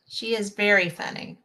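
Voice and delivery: ordinary delivery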